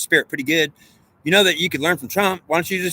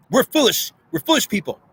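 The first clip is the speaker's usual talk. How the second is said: mock scolding; 8 semitones higher than usual. Voicing Trump scolding government.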